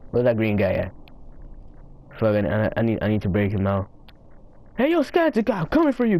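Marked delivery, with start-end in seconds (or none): 4.8-6.2 high called taunt, much higher; high playful call. Real, not acted. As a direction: shout across to a rival player. Calling to other player.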